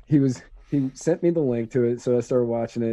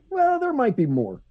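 mock quote; 11 semitones higher than usual. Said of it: "then he said".

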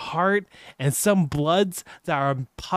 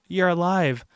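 ordinary delivery

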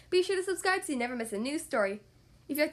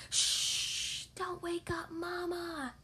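slow, soft hushed warning; hushed whisper; 2 semitones higher, 6 dB quieter, much slower than usual. Acted. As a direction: hush it urgently. Monkeys hushing each other.

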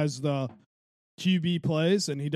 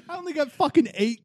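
laughing; 12 semitones higher, 3 dB louder than usual. Real, not acted. Laughs through own complaint.

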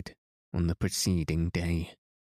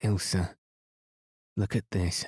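trembling voice. A narrator's reading. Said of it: "in a trembling voice".

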